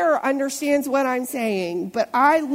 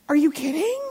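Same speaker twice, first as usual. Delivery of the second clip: higher, rising disbelief; mock incredulity; 5 semitones higher, faster than usual. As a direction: question it as if you can't believe your ears. Voicing an incredulous look.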